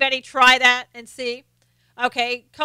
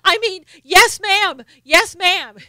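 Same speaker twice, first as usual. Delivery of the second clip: high, loud, hurried yes ma'am; a kid backpedaling fast to his knife-holding mama; frightened boy correcting himself; scared kid; 6 semitones higher, 8 dB louder, slower than usual. Retold or imitated. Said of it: "and he goes".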